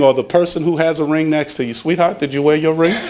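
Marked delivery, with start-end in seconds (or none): 1.7-2.9 motherly. Acted. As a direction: ask gently, like a loving spouse. Asks partner about wearing ring.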